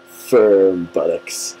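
ordinary talk, nothing marked

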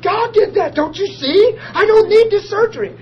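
high, loud triumphant cry; loud ecstatic insistence; excited shout. Retold or imitated. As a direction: shout it out like a revelation. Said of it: "I said".

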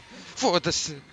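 high, loud curse; startled shout. Real, not acted. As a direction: blurt it out in fright. Startled outburst.

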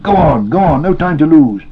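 high, loud and pressing; urgent urging. A narrator's reading. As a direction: shout him onward, no time to waste. Sam urges master onward.

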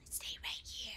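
hushed, voiceless whisper. Real, not acted. Player decides to stay put in the game, whispering. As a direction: whisper it softly to yourself.

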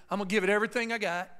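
loud, fast preacher's drive; preaching fast. Real, not acted. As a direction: rush it out like a fired-up preacher. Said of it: "I feel a preacher coming on here".

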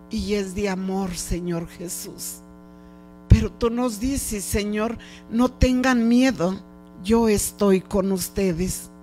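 ordinary talk, nothing marked

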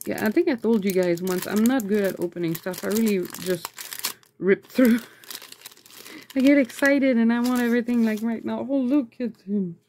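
usual speaking voice

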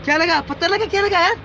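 what just hit you, real alarm; startled, rising questions; alarmed questioning. Real, not acted. Alarm after a companion is hit.

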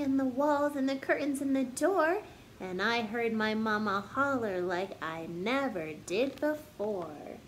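ordinary talk, nothing marked

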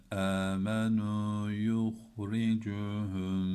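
slow, drawn-out chanted recitation; slow melodic tajweed chant; Quran recitation. Shown as a demonstration. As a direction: intone the verse slowly and melodically. Teacher recites Quran verse.

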